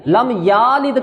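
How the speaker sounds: لم یالد with the ya stretched as yaa; یالد drawn out with an added alif; wrong long vowel. Shown as a demonstration. Teacher demonstrating students' mistake, slow. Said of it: "لم یلد".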